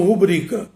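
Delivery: "rubrica" stressed on the middle syllable, ru-BRI-ca; tônica correta. Shown as a demonstration. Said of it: "Poucos pronunciam". ("rubrica" com tônica no BRI)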